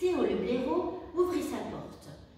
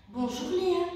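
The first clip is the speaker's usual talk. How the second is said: higher, louder cheerful call; 6 semitones higher, 5 dB louder than usual. A narrator's reading. Hedgehog calling out a greeting to the otter by the river, loud. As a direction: call out a friendly hello from afar. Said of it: "s'écria petit hérisson".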